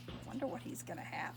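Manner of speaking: whispered aside. Real, not acted. Reader whispering to a child about Harold's picnic. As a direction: lean in and wonder aloud under your breath.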